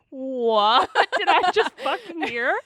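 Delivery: loud, high, slow outburst; shocked disbelief. Retold or imitated. Reenacting pausing the music in shock, incredulous. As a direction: stretch it out in stunned, amused outrage.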